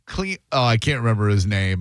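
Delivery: ordinary talk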